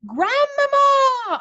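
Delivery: long, very loud high shout; boy shouting for grandmother through the door; shouting loudly. Acted. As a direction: yell as loud as you can. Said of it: "I shouted as loudly as I possibly could".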